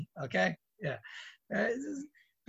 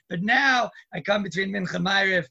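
ordinary talk, nothing marked

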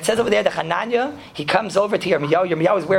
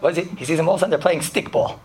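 usual speaking voice

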